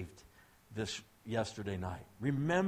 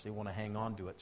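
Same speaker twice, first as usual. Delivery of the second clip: usual speaking voice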